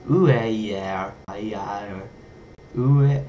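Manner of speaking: botched attempts at Estonian "Ue, yeah"; struggling pronunciation. Real, not acted. Reader attempting an Estonian word of all vowels, struggling pronunciation. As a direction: try "Ue, yeah" uncertainly, restarting it. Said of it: "I can't pronounce it right".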